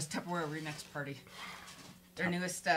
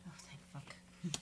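relieved whisper; 3 semitones lower, 15 dB quieter, slower than usual. Real, not acted.

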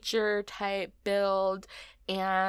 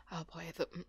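ordinary talk, nothing marked

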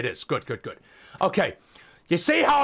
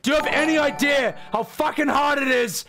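higher shouted begging and insults; mock angry rant; 4 semitones higher than usual. Streamer demanding subscriptions, hammed-up yelling. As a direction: yell at them to subscribe. Ranting subscription plea at viewers.

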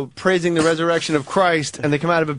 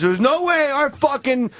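retold indignant rant, higher pitched; crazy angry; 7 semitones higher than usual. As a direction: imitate a man riled up in protest. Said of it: "then he got crazy angry".

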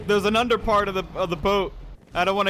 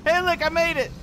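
high, amused boast; high-pitched cheerful surprise; giggly triumph; 10 semitones higher than usual. Real, not acted. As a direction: pipe up after a laugh. Happy to survive after laughing.

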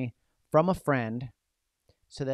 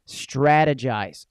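slow emphatic; 8 dB louder, much slower than usual. Real, not acted. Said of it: "emphasizing key word".

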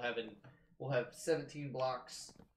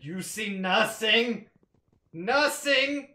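mock dramatic despair; 6 semitones higher, 8 dB louder, much slower than usual.

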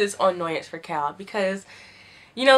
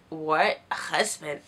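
slow confusion; 6 semitones lower, 4 dB quieter, much slower than usual. Retold or imitated.

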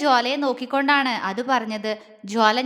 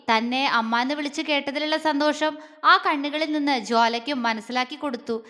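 plain reading voice

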